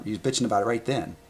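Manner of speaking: usual speaking voice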